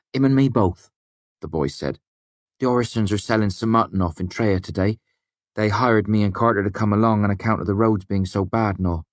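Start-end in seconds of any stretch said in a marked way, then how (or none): 0.1-0.9 put-on young prentice. A narrator's reading. The boy's voice. 2.6-9.0 put-on higher, eager country-boy voice; a lightly raised young man's voice; young smith's prentice. A narrator's reading. The boy's voice.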